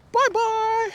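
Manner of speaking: very high, thin cartoon voice; squeaky high bye-bye. Real, not acted. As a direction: squeak it out like a toy. Squeaky goodbye to animals.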